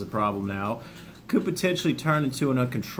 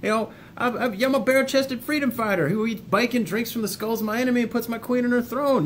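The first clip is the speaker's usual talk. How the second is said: higher, mock-macho bravado, brushing off grief; imitating a dismissive tough guy; 9 semitones higher than usual. Mocking viewers who say they don't need to grieve, in a hammed-up tough voice. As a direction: ham up the I'm-fine denial. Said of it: "sitting there watching this going".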